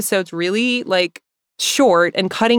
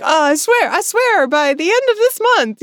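higher, pleading promise that won't be kept; mock earnest promise; 8 semitones higher than usual. Host imitating her past self promising the episode, mock pleading. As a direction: sell the vow a bit too hard, lightly mocking yourself. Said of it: "I'd definitely be like".

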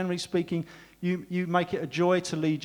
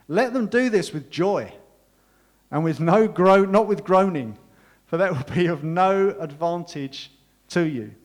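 slow, raised, higher proclamation of the verse; scripture reading; 4 semitones higher, 8 dB louder, slower than usual. Acted. Pastor reads Hebrews to his congregation, slow louder proclaiming. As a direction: lean on every word, louder and slower. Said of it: "he continues".

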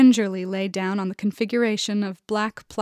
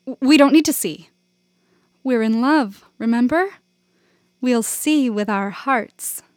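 high, bright coaxing, slowing at the end; playful persuasion; 4 semitones higher, 5 dB louder than usual. A narrator's reading. Janet talking Ron into the dark scene, coaxing. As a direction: coax him with mock romance.

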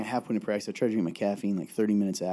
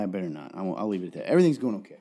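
ordinary delivery

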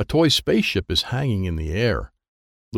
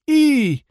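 sudden squeal, much higher and louder; 6 dB louder than usual. Acted. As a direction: squeal to be noticed. Hungry child squeals for attention.

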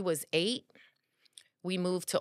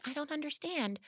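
small, high, soft confused voice; softly voiced bewilderment; imitated bewildered daughter; 10 semitones higher, 8 dB quieter than usual. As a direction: play a puzzled young worker, high and quiet. Voices bewildered daughter answering her manager.

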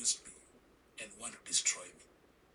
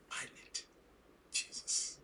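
whispered plea; slower than usual. Retold or imitated. His broken answer after crying.

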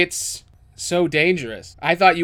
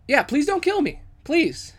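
pleading; 10 semitones higher than usual. Acted. Kobold begging for life.

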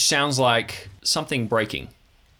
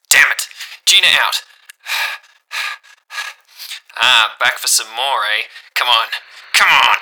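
loud fighting voice; 8 dB louder than usual. Acted. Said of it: "She goes".

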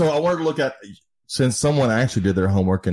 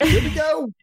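mock excited. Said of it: "What word are they gonna butcher next?".

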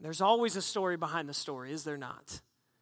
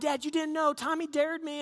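put-on high-pitched kid making excuses; acted-out boyish protest; pleading kid; 7 semitones higher than usual. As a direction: plead your case to dad in a higher voice. Child excusing himself to dad.

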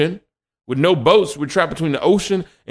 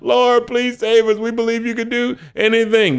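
begging; 8 semitones higher than usual. Retold or imitated. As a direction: voice the frightened crowd crying to God. Israelites begging God again.

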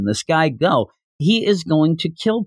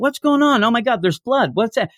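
higher-pitched mock alarm from onlookers; alarmed bystanders; 6 semitones higher than usual. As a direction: imitate a startled, confused crowd. Voices the alarmed crowd's reaction.

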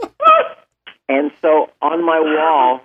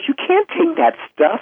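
higher-pitched mom voice scolding him. Retold or imitated. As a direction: play the mom, high and scolding. Mom scolding him over toilet paper.

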